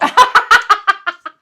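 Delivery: high burst of laughter; loud laugh. Real, not acted. Big laugh.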